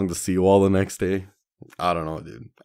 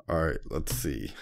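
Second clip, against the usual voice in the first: ordinary talk, nothing marked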